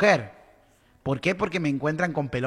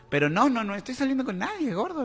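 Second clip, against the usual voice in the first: quick, high insistent denial; high protest; 8 semitones higher, faster than usual. Retold or imitated. Insisting to her ex she is seeing nobody, high and quick.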